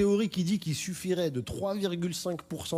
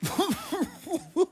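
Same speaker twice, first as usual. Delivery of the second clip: high cackle. Acted. Mireille laughs.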